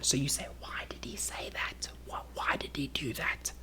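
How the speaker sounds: very soft, careful whisper; quiet, polite. Shown as a demonstration. Trying not to be rude to the person, very quiet. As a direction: hush it so it isn't rude. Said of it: "You're trying to be polite".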